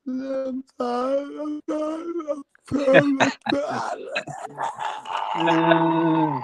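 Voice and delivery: mock drunk whimper, breaking into a cry; drunk Errol Spence. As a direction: wail it like a sleepy drunk baby. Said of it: "And he went".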